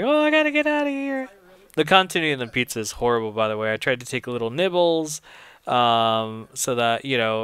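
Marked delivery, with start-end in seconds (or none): 0.0-1.3 much higher, hurried mock panic; a flustered guy backing out of a date, squeaky; startled intruder. Stumbled onto the date, flees in a squeaky mock panic. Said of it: "was just like".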